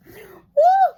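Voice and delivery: high, loud whoop; joyful woo. Real, not acted. Preacher whoops in praise to the congregation. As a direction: let out a praise shout.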